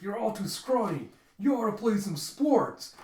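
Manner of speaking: high, loud acted jeer; loud taunting. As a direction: bellow a mock taunt at weaklings. Voices Casey mocking the attackers.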